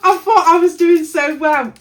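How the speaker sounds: real half-laughing, half-crying wail; laughing despair. Real, not acted. Vlogger finds yet another wrong piece, laughs and cries.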